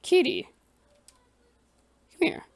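high, loud call to a cat; sing-song high call for the kitty. Real, not acted. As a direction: call the cat over from afar. Calling a cat over.

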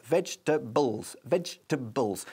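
"vegetables" slowly as vej-tuh-bulls; three syllables. Shown as a demonstration. Teacher modelling the word of the day, "vegetables" slowly in three syllables. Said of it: "decimos en tres sílabas".

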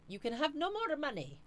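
high-pitched put-on accent, trying for menace; badly faked villain voice with a muddled accent; mock menacing. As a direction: attempt a menacing foreign-sounding voice and miss. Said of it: "I was trying to sound a bit more menacing".

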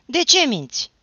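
angry yelled question; loud repeated accusation. A narrator's reading. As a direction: shout it high again across the fence. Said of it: "spuse el din nou".

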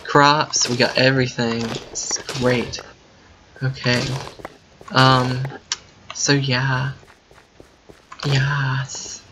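ordinary talk, nothing marked

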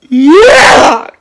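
high, loud, drawn-out whine; tattling pupil. Acted. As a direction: tell on a classmate in a high wail. Pupil telling on classmate.

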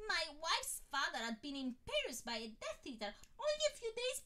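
high, loud, tearful babbling fan; grateful stranger telling his story, high and teary; gushing admirer. A narrator's reading. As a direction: pour it all out, high and emotional. Man gushing his tale.

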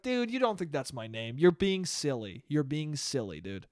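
soft, unbothered brush-off; taking it in stride. Acted. Imagined reply to being called Fredo, taking it in stride. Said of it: "took the Fredo in stride".

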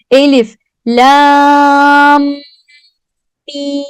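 very slow "Elif, lam, mim" with "lam" long; careful recitation. Shown as a demonstration. Teacher repeating pronunciation for class, slow and held. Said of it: "Tekrar telaffuz ediyorum".

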